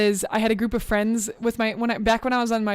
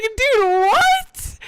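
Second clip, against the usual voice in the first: high, loud cry of disbelief; yelping in retold outrage; 13 semitones higher, 7 dB louder than usual. Retelling to chat how she yelled at the waiter, high and loud. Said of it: "I was so mad".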